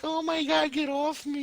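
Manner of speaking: high-pitched mock protest; shocked anime girl. Podcaster mocking the girl who wakes up in bed, mock protest. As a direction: squeal it in put-on indignation. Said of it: "now you wake up and you're like".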